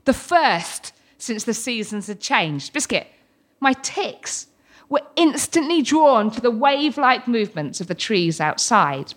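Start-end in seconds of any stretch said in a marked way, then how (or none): none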